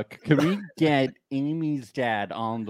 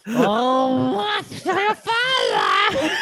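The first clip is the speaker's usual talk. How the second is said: put-on very high, slow, slurred drunk shout; getting drunker; 12 semitones higher, 3 dB louder, much slower than usual. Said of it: "it's getting more and more slurry".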